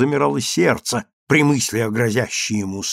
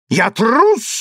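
high, outraged exclamation; raised-pitch narrated shout; indignant cry; 9 semitones higher, 3 dB louder than usual. A narrator's reading. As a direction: cry out in offence at the insult. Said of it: "закричал Александр".

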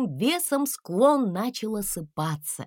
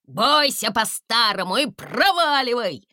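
angry dragon; 5 semitones higher, 5 dB louder than usual. A narrator's reading. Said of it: "В тумане злились".

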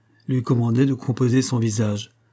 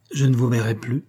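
voix altérée, légèrement peinée; voix changée. A narrator's reading. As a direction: laisser le timbre changer sous le coup. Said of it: "dit-il d'une voix aussi changée". (chagrin contenu)

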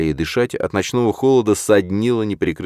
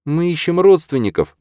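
high, loud, hurried support; eager; 12 semitones higher, 5 dB louder than usual. A narrator's reading. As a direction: jump in eagerly. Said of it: "поддержала Ирида".

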